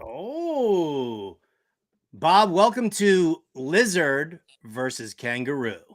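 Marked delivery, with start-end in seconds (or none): none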